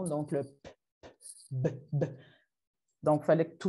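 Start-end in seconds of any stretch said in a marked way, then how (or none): 0.0-2.0 "p" said without voice, then "b" with voice; voiced vs voiceless. Shown as a demonstration. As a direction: contrast "p" voiceless against "b" voiced. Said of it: "de la vibration ou non". (« p » sourd puis « b » sonore)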